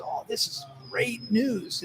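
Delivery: a light sketch of a cheery father; cheerful dad. Retold or imitated. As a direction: play the upbeat father, drawn out. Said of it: "Dad comes up and says".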